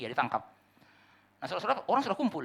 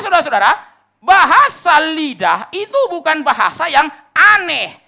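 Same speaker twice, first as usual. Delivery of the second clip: preaching at full force; 11 semitones higher, 16 dB louder than usual. Acted. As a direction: hammer each word home from the pulpit.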